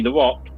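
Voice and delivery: "The Rock" with "Rock" said as "wock"; says w for r. Real, not acted. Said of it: "I can't say my R's properly".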